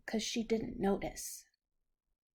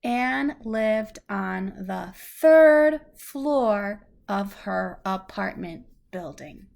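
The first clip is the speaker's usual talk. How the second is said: clear, measured storybook reading; reading to a child; 5 dB louder, slower than usual. Acted. Parent reads a picture book to a child, gentle storybook reading. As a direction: read it out slowly for a little listener.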